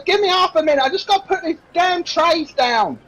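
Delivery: put-on high-pitched, put-upon serving girl; harassed barmaid, voice pitched right up; exasperated barmaid. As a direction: answer a nagging boss, thoroughly fed up.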